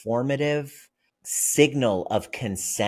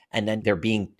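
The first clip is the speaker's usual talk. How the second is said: usual speaking voice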